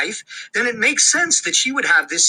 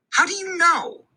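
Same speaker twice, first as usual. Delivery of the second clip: lightly voiced mom, higher; hushing, distracted mother; busy mom; 11 semitones higher than usual. A narrator's reading. Mom on hold hushing her son, distracted and higher.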